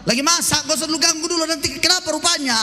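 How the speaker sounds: jawaban ketus, tinggi dan keras. Pretend. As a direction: istri membentak suami yang menelepon. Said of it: "Terlalu jenggo kau". (menjawab ketus, lebih tinggi dan keras)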